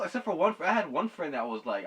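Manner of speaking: ordinary talk, nothing marked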